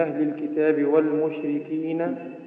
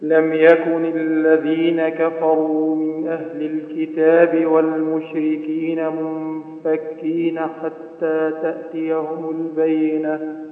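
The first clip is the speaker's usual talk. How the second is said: Quran recitation; 6 dB louder, slower than usual. Acted. Student reciting to the teacher, steady melodic recitation.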